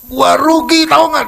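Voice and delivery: high, loud indignant yell; angry complaint. Retold or imitated. Friend complains angrily to the speaker about his loss, high loud yell. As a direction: shout your loss at him. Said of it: "Dia marah sama saya".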